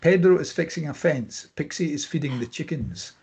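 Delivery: normal talking voice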